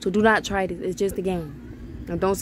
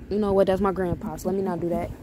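ordinary delivery

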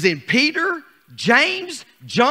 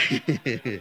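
soft low chuckle; 10 semitones lower, 8 dB quieter than usual. Real, not acted. Holy laughter.

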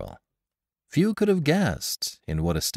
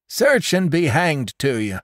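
higher, louder defiant retort; defiant shout; 9 semitones higher, 5 dB louder than usual. A narrator's reading. Old Jones to the constable, defiant, higher and louder. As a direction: throw it back at them.

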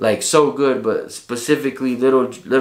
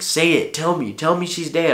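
raised-pitch demand, yelled only in imitation; retold yelling; 4 semitones higher than usual. Son demanding news of his mother by phone, retold yelling. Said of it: "I'm yelling into the phone".

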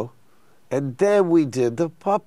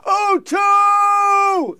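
high, booming arena shout; 5 dB louder than usual. Retold or imitated. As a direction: call it out to a huge crowd.

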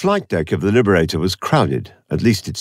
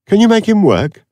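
high, louder eager question; Villa asking; 7 dB louder than usual. A narrator's reading. Villa asks Avon about the computer, high and eager. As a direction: push the question up high, wide-eyed. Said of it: "Villa asked".

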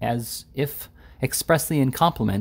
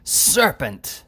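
higher, saw-edged exclamation; 5 semitones higher than usual. A narrator's reading. Angry editor accusing Mister Winkle, saw-like voice. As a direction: cry it out with a sawing edge. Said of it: "exclaimed in a saw-like voice".

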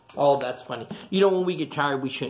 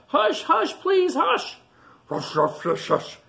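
high loud hushing, then rustling noises; porcupine; 4 dB louder, slower than usual. A narrator's reading. Porcupine hushes the sleeper, high pleading voice. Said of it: "says the porcupine".

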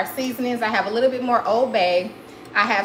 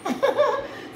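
real laugh, high-pitched. Real, not acted. Mom laughing at her daughter's impatience, high laugh. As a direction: let a laugh burst out high.

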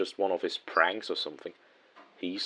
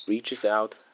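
ordinary delivery, nothing marked

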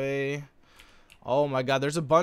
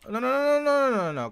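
panicked; 11 semitones higher, 5 dB louder than usual. Real, not acted. Raid goes wrong suddenly.

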